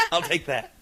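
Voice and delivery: laughing through the words; real laugh, pleased to take the compliment. Real, not acted. Pleased by the listener's reply, laughing it off.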